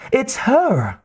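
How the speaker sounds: high, excited squeal of recognition; nervous young man, thrilled. A narrator's reading. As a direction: blurt it out thrilled, voice jumping way up. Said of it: "Otacon sounded thrilled".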